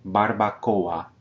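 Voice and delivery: Barbacoa slow, oa as two beats; syllables separated. Shown as a demonstration. Teacher repeats Barbacoa slowly, vowels split. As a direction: draw out Barbacoa, oa apart. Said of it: "into two distinct syllables".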